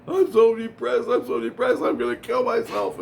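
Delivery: high, whiny imitation of a despairing girl; put-on high-voiced teen lament; distraught teenage girl. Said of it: "this girl, she comes running up. She goes".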